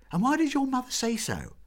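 high, loud insistent question; eager question. Acted. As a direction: demand an answer. Girls press Meg eagerly.

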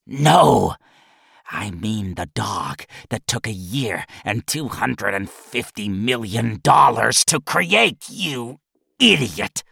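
put-on old boss losing his temper; angry snarl. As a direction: berate the assistant. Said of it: "snarled angrily".

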